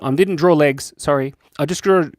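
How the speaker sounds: ordinary talk, nothing marked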